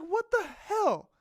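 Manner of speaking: high, slow, drawn-out disbelief. Retold or imitated. Retelling the ATM swallowing his five, slow high disbelief. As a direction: stretch it out, baffled.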